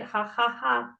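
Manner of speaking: deliberate, spaced-out ha-ha-ha; demonstrated mock laughter; fake laugh. Shown as a demonstration. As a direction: force out a pretend laugh. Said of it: "Fake it till you make it".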